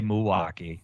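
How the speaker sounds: Milwaukee said the local way, letters skipped, muwaukee; local pronunciation. Shown as a demonstration. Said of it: "we just skip a few letters".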